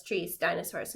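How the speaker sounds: ordinary delivery, nothing marked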